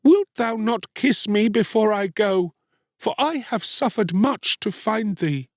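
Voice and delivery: high, sorrowful plea; crying out. A narrator's reading. Mother asking a last kiss, sorrowfully pleading. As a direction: implore in a lifted woman's voice. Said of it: "she cried".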